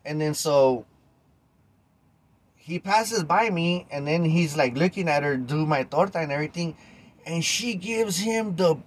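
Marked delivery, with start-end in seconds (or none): none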